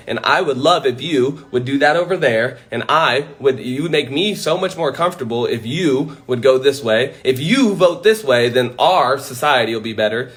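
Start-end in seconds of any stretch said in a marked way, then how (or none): none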